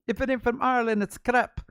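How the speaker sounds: high, loud mock Irish accent; hammed-up Irish yelp; fake Irish accent. Bored coworker answering phones, loud fake Irish accent. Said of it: "sometimes he'd be Irish".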